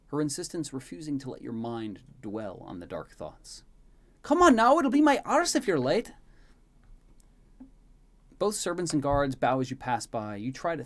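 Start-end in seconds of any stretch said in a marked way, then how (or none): 4.2-6.1 high, very loud nagging; scolding shout. Acted. Maid pulling the prince down the hall, scolding shout. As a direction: hustle them along at a high-pitched yell. Elaine hurries the prince along.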